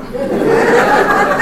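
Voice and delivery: a bright, high burst of laughter; real laugh. Real, not acted. Lecturer laughs with the audience at his Yankees joke, laughing.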